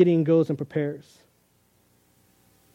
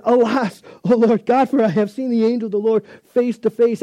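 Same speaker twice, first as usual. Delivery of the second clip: Alas rising louder and higher; dramatic cry; 5 semitones higher, 6 dB louder than usual. Retold or imitated. Gideon seeing the angel, a read-out dramatic cry. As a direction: cry it out in mild awe.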